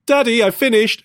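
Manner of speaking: put-on child repeating his line to dad; insistent repeat. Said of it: "Then he'll say".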